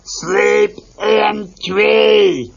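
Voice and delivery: slow, loud growling shout; Animal insisting. Acted. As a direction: bellow it like a wild drummer. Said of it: "Animal insisted".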